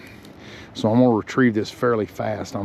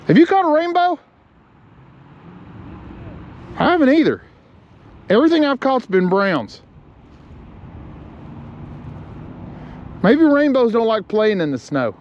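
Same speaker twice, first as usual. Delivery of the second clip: calling out; 11 semitones higher than usual. Real, not acted. Calling to partner across river.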